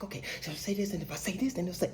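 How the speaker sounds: very soft muttered rehearsal; inner thought. Retold or imitated. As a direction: mutter options to yourself, barely audible. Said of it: "you go through your head".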